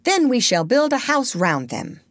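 high, decisive little leader; giving orders. A narrator's reading. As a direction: lay out the plan as a command. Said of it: "Peter ordered".

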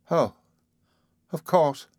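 high-pitched, nervous cry; flustered and high, broken by pauses; a touch of hysteria; hysterical. A narrator's reading. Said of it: "she cried hysterically".

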